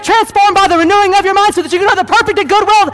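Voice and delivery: high, shouted pulpit fervor; full-force preaching. Real, not acted. Preacher warning congregation about the enemy, shouted full force. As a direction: cry it out with driving force.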